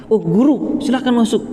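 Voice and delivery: lightly acted respectful surprise; surprised greeting. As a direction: play a pupil startled to find his teacher at the door. Student greets teacher arriving at night.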